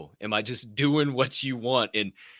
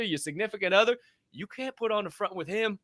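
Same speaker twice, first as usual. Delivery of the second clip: usual speaking voice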